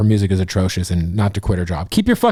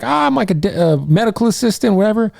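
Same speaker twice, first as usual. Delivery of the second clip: put-on high girly voice; 8 semitones higher than usual. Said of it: "She's like".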